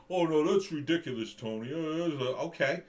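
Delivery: a hammed-up dismissive critic, then flustered; mocking woke critic. Mocking an imagined woke opponent.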